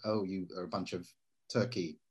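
soft, limp insult with no force; weak delivery. Shown as a demonstration. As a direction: toss the insult off feebly. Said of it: "you can't just speak it like me".

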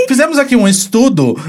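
laughing while announcing. Real, not acted. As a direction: laugh through the line. Laughs before study.

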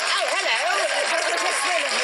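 drawn-out, high, hammed-up posh English "Oh, hello"; mock posh English accent. Host mocking [name]'s put-on English accent, slow and high. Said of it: "started being like".

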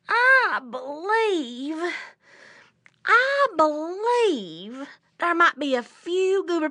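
high, drawn-out drowsy rabbit voice; waking rabbit. Acted. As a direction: stretch it high, as if stretching awake.

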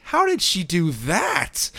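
slow, drawn-out, mock-puzzled; stunned at the nonsense; baffled disbelief. Baffled by nun's power.

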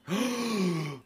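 long, high shocked gasp; hammed-up gasp of realization; mock gasp. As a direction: suck in air in pretend astonishment. Acts shock at remembering.

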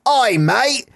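high mock Australian Oi mate; Australian accent. Mocking Australians in dubs, high Aussie accent. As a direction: ham up a cheap Aussie voice. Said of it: "an Australian film".

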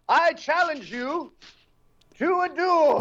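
high, slow, grand challenge; mock duellist. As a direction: proclaim it like a theatrical swordsman.

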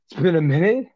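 high, disbelieving mock question; incredulous question. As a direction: ask it in acted disbelief. Exhausted swimmer hears time left.

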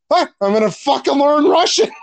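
high, emphatic mocking quote; hammed-up determined outburst; mock resolve. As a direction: imitate someone loudly declaring a big plan. Mocking the actor's last-minute resolve.

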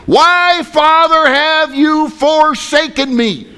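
high, loud, drawn-out cry to a father; anguished cry. Acted. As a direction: cry out slowly in forsaken agony. Jesus crying out from cross.

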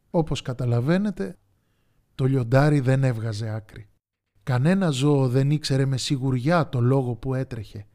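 ordinary delivery

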